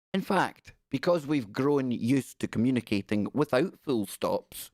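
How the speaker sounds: ordinary delivery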